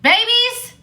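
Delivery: high, loud disbelief; incredulous outburst. Retold or imitated. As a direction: blurt it out in mock outrage. Acted outrage at the babies remark.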